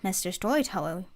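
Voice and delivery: ordinary delivery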